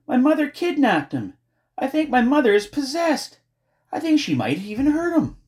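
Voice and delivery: high-pitched panicked outpouring; screaming. A narrator's reading. Girl telling friend of the kidnapping, frantic screaming. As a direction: cry out frantically, high and loud. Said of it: "she screamed".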